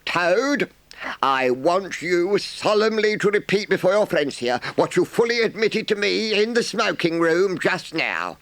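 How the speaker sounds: high, measured badger voice; solemn badger. A narrator's reading. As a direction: formally lead Toad to confess. Badger addresses Toad solemnly.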